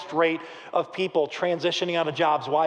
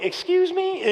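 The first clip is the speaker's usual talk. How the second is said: high-pitched, disbelieving question; incredulous; 12 semitones higher, slower than usual. Acted. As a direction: balk at it in disbelief, pitch jumping up. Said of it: "he's, Gideon's really like".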